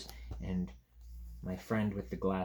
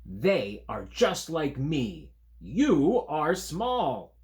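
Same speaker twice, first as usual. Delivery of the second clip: slow, louder, stubborn creature line; insistent creature; 6 semitones higher, 8 dB louder, much slower than usual. Acted. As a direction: drag it out like a sulky creature.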